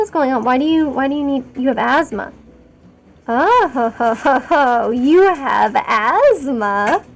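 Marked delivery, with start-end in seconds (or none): 3.3-7.0 drawn-out theatrical ho-ho, then a very slow question; mock-grand taunting. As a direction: stretch it out with hammed-up relish.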